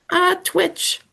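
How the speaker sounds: high crone voice; weird witch. Acted. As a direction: snap the punchline like a witch. Witch answers riddle.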